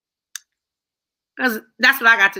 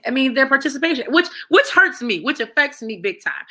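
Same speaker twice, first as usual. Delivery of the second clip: usual speaking voice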